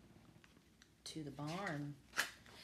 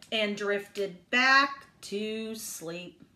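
usual speaking voice

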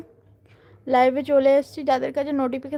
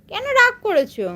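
much higher, sing-song coaxing of a sulking bird; high coaxing pet talk; 9 semitones higher than usual. Real, not acted. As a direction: plead sweetly up high with the pet. (উঁচু গলায় আদুরে মান ভাঙানো)

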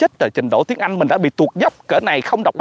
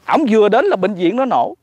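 ordinary talk

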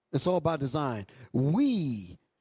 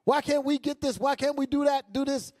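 high, exasperated nagging wife; fed-up wife; 10 semitones higher than usual. Retold or imitated. Fed-up woman scolding her man about what they lack, high and exasperated. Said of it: "she's fed up, she's mad".

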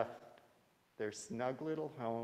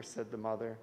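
ordinary delivery, nothing marked

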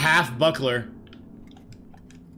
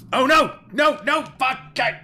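panicked yell; 13 semitones higher, 4 dB louder, slower than usual. Real, not acted. Sudden fall in the game.

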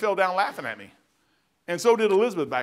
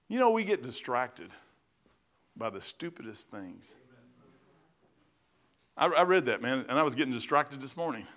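normal talking voice

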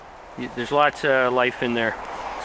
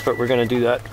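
usual speaking voice

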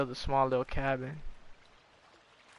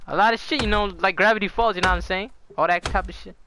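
excited; 5 semitones higher, 6 dB louder than usual. Real, not acted. Excited listing of dangers.

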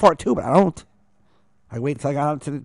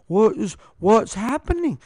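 lost infant voice; 9 semitones higher than usual. Retold or imitated. Imitating buddy looking lost.

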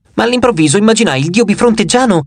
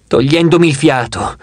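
ordinary delivery, nothing marked

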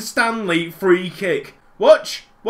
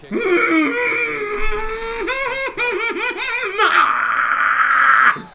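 long high cackle; 11 semitones higher than usual. Real, not acted. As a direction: laugh hard in disbelief. Laughing at opponents' play.

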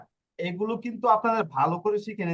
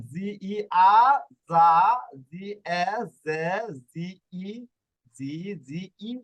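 slow syllable demo; slower than usual. Shown as a demonstration. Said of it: "কিভাবে হয় দেখুন".

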